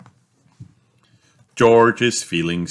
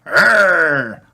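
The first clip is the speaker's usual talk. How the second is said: put-on toddler dinosaur roar; 11 semitones higher, 6 dB louder than usual. [name] roars happily.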